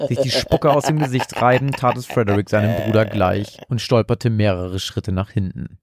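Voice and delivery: usual speaking voice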